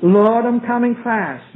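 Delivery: ordinary talk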